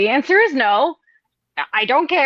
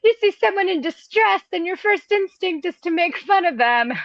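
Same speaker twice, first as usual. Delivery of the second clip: mock scolding; 7 semitones higher than usual. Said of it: "they like to yell at me".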